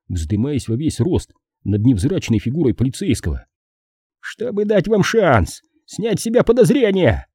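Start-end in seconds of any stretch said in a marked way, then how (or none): 4.2-7.3 sharp, high-pitched snap back; angry retort. A narrator's reading. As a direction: fire it back in a much higher voice. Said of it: "рявкнул Пейнтер". (злая реплика высоким голосом)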